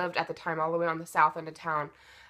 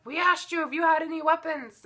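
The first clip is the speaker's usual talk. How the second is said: angry cop; 9 semitones higher than usual. Retold or imitated. Cop scolding during pat down.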